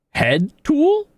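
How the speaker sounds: confused. Real, not acted. Confused by item text.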